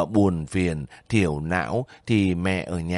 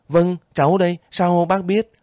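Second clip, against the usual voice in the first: higher, eager, about to cry out with joy; eager exclamation; 9 semitones higher, 4 dB louder than usual. A narrator's reading. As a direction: burst out gladly on the question. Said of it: "Vũ đã định reo lên". (reo lên mừng rỡ, giọng cao)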